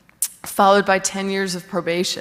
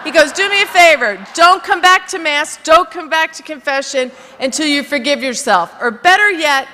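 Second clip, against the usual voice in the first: firm lecture; 5 semitones higher, 5 dB louder than usual. Retold or imitated. Priest lectures her firmly.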